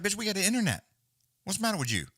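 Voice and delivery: high-pitched incredulous comeback; indignant mock telling-off, pitched high; exasperated retort. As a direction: scold her as if it were obvious. Imagined retort to streamer.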